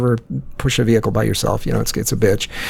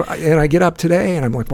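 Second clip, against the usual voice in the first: ordinary talk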